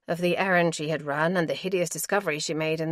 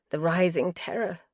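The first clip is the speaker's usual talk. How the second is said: ordinary delivery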